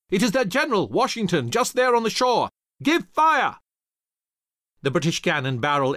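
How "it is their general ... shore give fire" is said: high, loud officer's call; raised voice climbing to a shouted order; urgent shouted command. A narrator's reading. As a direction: call out the target, building to a yell. British commander spots enemy, orders fire.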